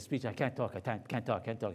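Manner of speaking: low, hushed, stammering repetition; quietly lost for words, repeating himself; speechless. Retold or imitated. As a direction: act mildly dumbstruck, voice sunk low. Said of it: "I'm speechless".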